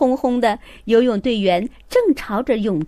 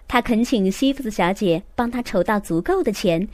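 ordinary delivery

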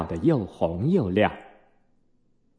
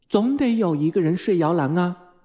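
mildly stern mother voice; higher-pitched, understated scolding; almost stern; 7 semitones higher than usual. A narrator's reading. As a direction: insist like a firm mother. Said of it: "温蒂几乎是声色俱厉地说".

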